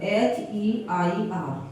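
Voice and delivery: H E I R letter by letter, very slow; spelling out. Shown as a demonstration. Teacher spelling the word for students, very slowly.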